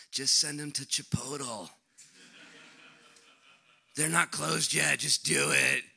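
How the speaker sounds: lightly voiced complaining disciple; whiny disciples. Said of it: "They're like".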